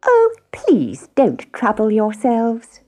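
high, slow, mock-sweet hen; sarcastic politeness. A narrator's reading. Hen refusing lazy housemates, slow high sarcasm. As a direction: draw it out high with pointed courtesy.

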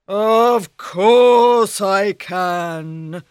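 loud, very slow ogre brag; boasting ogre. Acted.

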